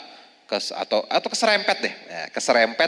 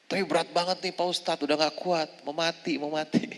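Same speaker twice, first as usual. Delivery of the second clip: whiny complaint; 7 semitones higher, 5 dB quieter than usual. Retold or imitated. Mother complaining to ustadz.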